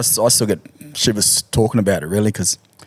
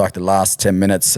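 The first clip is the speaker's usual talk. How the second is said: usual speaking voice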